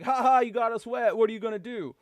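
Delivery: half-yelled mock gloating; mock taunting yell. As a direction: imitate a gang's gloating taunt. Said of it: "they yell at him".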